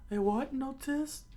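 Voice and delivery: very high, slow falsetto question; high cartoon bafflement; baffled listener. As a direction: squeak it out, slowly baffled. Mishearing acted in the story.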